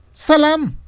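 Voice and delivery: very high, loud greeting; thrilled woman calling out hello; excited exclamation. A narrator's reading. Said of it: "exclamó. Parecía emocionada". (saludo agudo y emocionado)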